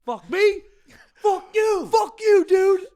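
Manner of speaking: screaming it back at them; furious shout. Retold or imitated. Retold comeback to a rude stranger, shouted. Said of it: "And I'm like".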